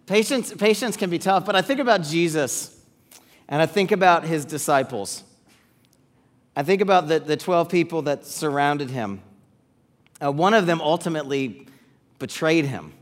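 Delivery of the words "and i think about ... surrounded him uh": ordinary talk, nothing marked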